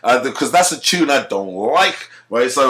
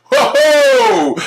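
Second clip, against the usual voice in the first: mock startled; 7 dB louder than usual. His startled reaction.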